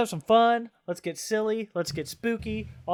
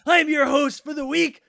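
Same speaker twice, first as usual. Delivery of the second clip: gruff, shouted wrestler promo; Macho Man Randy Savage; 6 semitones higher, 7 dB louder, slower than usual. Pretend. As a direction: growl it like a hyped wrestling announcer. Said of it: "of course, Macho Man".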